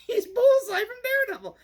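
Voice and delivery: loud, very high mock squeal; astonished reveal. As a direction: imitate a crowd's shrill-pitched revelation. Quoting the audience's astonished answer.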